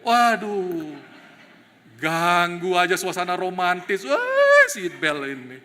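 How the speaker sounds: loud, high mock annoyance; hammed-up groan at the seat belt; comic frustration.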